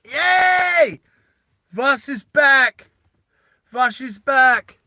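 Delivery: high, loud, slow triumphant cheer; excited cheer. Real, not acted. Player cheering his character back to the table, loud and high. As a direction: celebrate the return, stretching each word.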